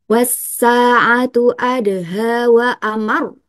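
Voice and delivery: slow recitation, "amar" pressed at the end. Shown as a demonstration. Teacher demonstrating naber, slow chant with stressed "amar". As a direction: chant slowly and lean on the last syllable of "amar". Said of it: "intonasinya agak dinaikkan sedikit".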